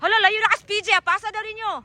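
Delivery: high, carrying shout; calling out. Real, not acted. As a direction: holler to someone far out at sea. Calling to people far out. (kusog ug taas nga pagtawag)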